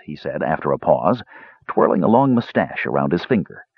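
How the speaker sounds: usual speaking voice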